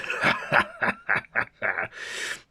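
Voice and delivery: loud villainous cackle; evil laugh. Acted. Hyde gloating as he emerges, loud evil cackle. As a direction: cackle at your own power.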